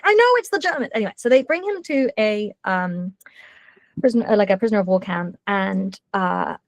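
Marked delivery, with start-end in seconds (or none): 0.0-0.9 high, weary exasperation; exasperated pilot. Retold or imitated. Pilot answering his co-pilot, high and exasperated. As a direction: humour him, fed up. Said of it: "He's like".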